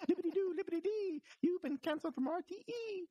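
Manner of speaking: high sing-song Irish leprechaun voice; squeaky, gleeful Irish leprechaun taunt. Pretend. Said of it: "the weird leprechaun voice that I seem to be doing".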